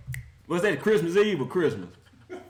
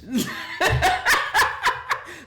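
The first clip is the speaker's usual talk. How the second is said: high wheezing laugh; 12 semitones higher than usual. Real, not acted. Mocking the Browns' choke.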